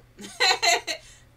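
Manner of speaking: bright high burst of laughter; real laugh. Real, not acted. Laughing at her own emphasis to the viewers, loud high laugh.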